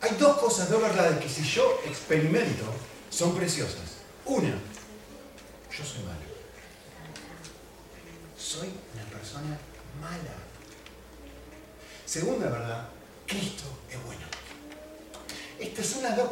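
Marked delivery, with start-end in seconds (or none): 4.2-10.3 solemn slow confession. Real, not acted. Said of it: "solemn key truth".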